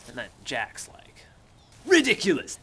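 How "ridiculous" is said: high, loud pompous dismissal; scornful exclamation. Retold or imitated. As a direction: scoff it out theatrically. Jack dismissing, acted.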